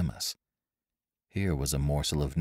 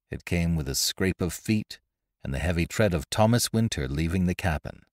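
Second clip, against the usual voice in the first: ordinary delivery, nothing marked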